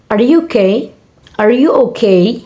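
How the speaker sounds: "Are you okay" as a yes-no question, lifting upward; rising intonation. Shown as a demonstration. Student demonstrating a yes-no question, rising tune on "Are you okay". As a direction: let "okay" climb at the end of "Are you okay". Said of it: "a rising intonation is usually".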